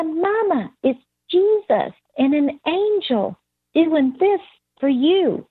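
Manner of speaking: put-on high, wondering toddler voice; imitated little girl. Toddler telling mama she sees Jesus, imitated child voice. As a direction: tell it in a high small-child voice. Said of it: "And she said".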